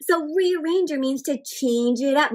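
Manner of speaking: ordinary delivery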